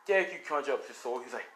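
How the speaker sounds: ordinary delivery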